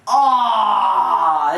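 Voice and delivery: long, loud mock groan. Player reacts to teammate's loud fart, groaning loudly. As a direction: imitate a disgusted "aw" holler.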